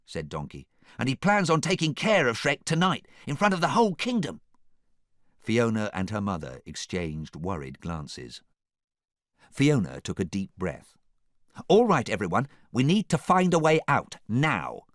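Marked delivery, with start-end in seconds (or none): 1.0-4.4 high, loud, urgent report; Donkey. A narrator's reading. Donkey warns Fiona about Shrek, high and urgent. 11.7-14.8 raised, firm rallying voice; rally them, louder and higher; taking charge. A narrator's reading. Fiona rallies everyone to escape, raised commanding voice.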